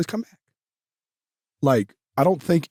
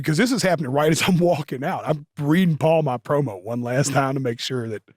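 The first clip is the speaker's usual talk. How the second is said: usual speaking voice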